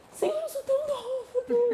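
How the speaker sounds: high, laughing plea to God; half-laughing mock lament to God; mock pleading prayer. Woman retells crying out to God, high laughing plea. Praying in distress, retold.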